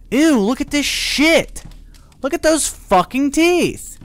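high, slow drawn-out disgust. Real, not acted. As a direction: recoil at the ugly teeth. Disgusted at monster teeth.

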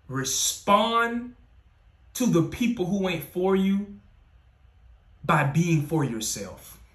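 high, drawn-out stress on Respond; emphatic preaching. Acted. Preacher telling listeners to respond, slow and emphatic. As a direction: land each word slowly.

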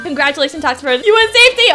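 usual speaking voice

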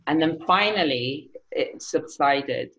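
usual speaking voice

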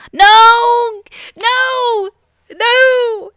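high, loud playful protest; hammed-up cry of denial; mock outraged no.

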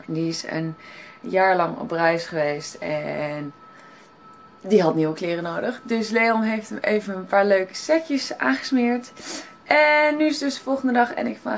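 normal talking voice

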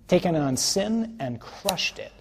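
triumphant slow proclamation; fervent refrain. Real, not acted. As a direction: hammer sin and crushed it.

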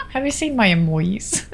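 usual speaking voice

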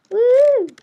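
high, loud cheer of triumph; victory whoop. Real, not acted. Player cheering as the rival falls, high loud whoop.

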